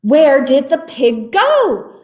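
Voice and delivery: drawn-out, loud storybook cry. A narrator's reading. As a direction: call it out as bewildered animals. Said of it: "the animals cried".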